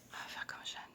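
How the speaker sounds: chuchotement soufflé et appuyé; chuchoté. Real, not acted. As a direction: murmure sans voix. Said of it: "Si je parle tout bas". (voix chuchotée)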